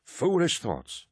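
high, slow, lordly dismissal; a touch of pompous scorn; dismissive old captain. A narrator's reading. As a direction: wave it off from on high. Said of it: "Cruz decided".

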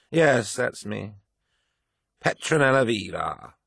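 put-on slow, slurred drunk lady; drunk woman. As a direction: drag the words, tipsy and bitter. Drunk woman introduces herself.

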